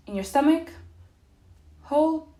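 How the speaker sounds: high, loud words on a full breath; holding breath. Real, not acted. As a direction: squeeze the words out on held air. Talks while holding an inhaled breath.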